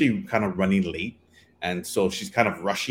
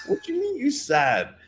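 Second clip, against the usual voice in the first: high-pitched incredulous question; squeaky mock shock; disbelieving. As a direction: laugh in disbelief as you quote yourself. Retold disbelief at her complaint.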